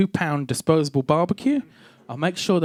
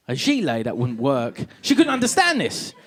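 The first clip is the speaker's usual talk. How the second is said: ordinary talk, nothing marked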